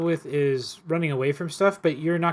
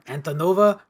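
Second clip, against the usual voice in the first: normal talking voice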